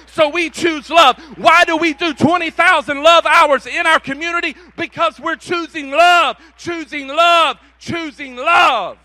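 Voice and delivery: shouted, fired-up sermon climax; roaring pulpit cry; full-force preaching. Real, not acted. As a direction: preach it out at the top of your lungs.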